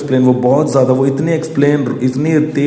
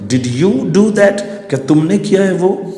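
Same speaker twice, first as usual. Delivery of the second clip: put-on a father confronting his child; pitched-up stern accusation; stern father demand; 7 semitones higher, slower than usual. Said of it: "क्या बोला".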